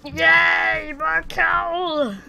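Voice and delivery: high, loud cheer; excited shout. Real, not acted. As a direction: shout with glee at a lucky find. Cheering more coal found.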